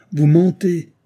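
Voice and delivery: loud accusing cry; exclaiming. A narrator's reading. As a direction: hurl the accusation at her. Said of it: "s'écria Edward Arundel".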